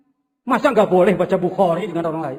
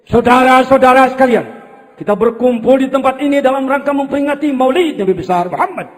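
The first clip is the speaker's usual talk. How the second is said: loud, formal Maulid opening address; Habib Zain; 5 semitones higher, 8 dB louder than usual. Pretend. Preacher playing Habib Zain opening a Maulid gathering, loud and formal. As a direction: address the crowd like an orator at a Maulid. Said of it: "Ini Habib Zain".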